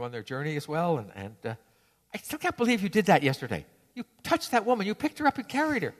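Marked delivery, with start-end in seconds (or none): 2.1-5.9 high, indignant nagging; angry scolding. Acted. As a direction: keep needling him about the woman. Monk still scolding next day.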